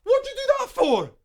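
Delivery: high shocked yelp; shocked outcry. Real, not acted. Character struck across neck.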